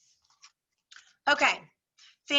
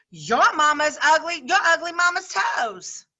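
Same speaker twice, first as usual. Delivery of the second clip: higher, louder playground taunt; taunting shout; 5 semitones higher, 4 dB louder than usual. Acted. Girl shouting a mama insult at rival, taunting. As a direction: yell the insult like a kid.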